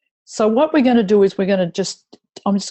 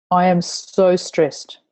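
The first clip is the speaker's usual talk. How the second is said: slow, measured phrases between tapping points; tapping reminder phrases. Acted. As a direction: lead the group through each point with mild voiced distress.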